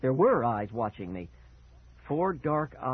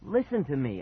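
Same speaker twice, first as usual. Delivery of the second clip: begging; 6 semitones higher than usual. Acted. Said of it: "I begged".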